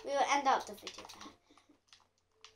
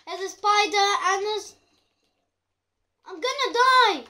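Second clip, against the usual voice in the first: loud high panicked cry for help; screaming at a spider; 6 semitones higher, 8 dB louder than usual. Real, not acted.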